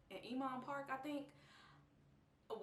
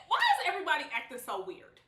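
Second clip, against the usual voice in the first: baffled; 8 semitones higher, 10 dB louder, faster than usual. Retold or imitated. Confused outburst.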